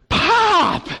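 high, loud mock squeal of delight; excited greeting imitation. Pastor imitating her seeing him come back, excitedly. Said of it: "she's all excited to see me".

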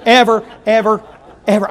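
loud, high, laughing preacher evers; Brother Harper impression. Preacher mimicking a colleague, hammed-up loud evers. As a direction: ham up a fellow preacher's evers. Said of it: "Can I be like Brother Harper".

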